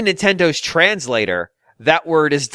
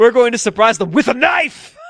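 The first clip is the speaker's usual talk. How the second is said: high, hammed-up threat; playful yell, higher than usual; mock-menacing shout; 7 semitones higher, 3 dB louder than usual. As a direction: shout it as a gleeful joke threat. Mock threat twisting the slogan.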